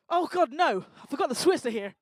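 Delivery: loud, high comic dismay; hammed-up "oh no" outburst; mock horror. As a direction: cry out as if caught out. Mock horror at Swiss audience.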